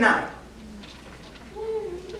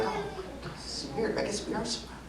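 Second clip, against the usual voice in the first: hushed whisper, uneasy; 11 semitones higher, 14 dB quieter than usual. Retold or imitated. To Chad as the cop stares them down, whispered. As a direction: whisper it to your friend.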